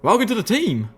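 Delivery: high, hearty folksy welcome; Calamity. A narrator's reading. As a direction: welcome them with gusto, pitched high.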